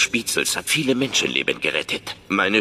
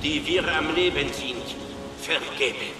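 solemn prayer; 11 semitones higher than usual. Acted. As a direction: intone the blessing over the congregation. Same prayer said again.

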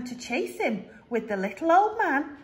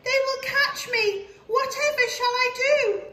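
high, worried cookie voice; gingerbread man voice; 13 semitones higher, 4 dB louder than usual. Acted. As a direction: fret about being caught. Cookie panicking at river.

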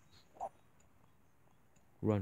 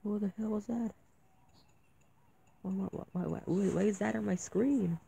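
scared whisper-like; 8 semitones higher, 5 dB quieter than usual. Real, not acted. Creepy figure appears on screen.

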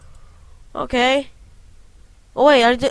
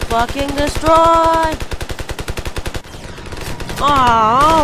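battle yell; 6 semitones higher, much slower than usual. Real, not acted. Charging enemy, yelling.

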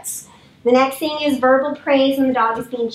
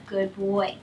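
soft praise to dog; 5 semitones lower, 9 dB quieter than usual. Real, not acted. Dog trainer praising dog softly.

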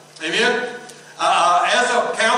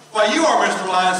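usual speaking voice

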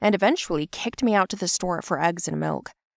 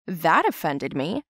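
usual speaking voice

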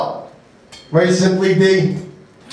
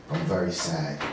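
very low, much softer deadpan rumble; sad Vin Diesel; 13 dB quieter than usual. Acted. Comic doing Vin Diesel being sad, very low and soft. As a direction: drop to the bottom of your voice and mumble it flat. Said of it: "I'd now like to do my Vin Diesel impersonation. Vin Diesel being sad".

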